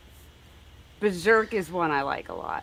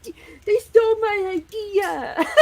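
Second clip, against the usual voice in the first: mock childish outrage, much higher, half laughing; upset little kid; 6 dB louder, slower than usual. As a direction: whine it like a cheated child. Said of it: "I was pissed".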